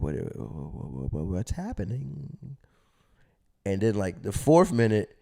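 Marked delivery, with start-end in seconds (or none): 0.0-2.6 confused muttering. Retold or imitated. Retold confusion, low and slow.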